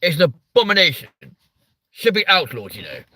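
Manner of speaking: hammed-up posh English outrage; mock posh voice. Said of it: "we now sound like the fucking Tory party".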